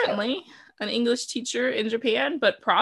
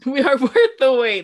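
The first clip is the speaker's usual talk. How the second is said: laughing echo; 4 semitones higher, 7 dB louder than usual. Acted. Echoing with a laugh.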